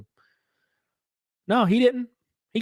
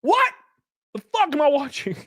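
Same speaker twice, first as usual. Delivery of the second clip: high-pitched, incredulous disbelief; exasperated squeak of disbelief; baffled outburst; 12 semitones higher than usual. Retold or imitated. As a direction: blurt it out as an appalled viewer. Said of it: "I'm like".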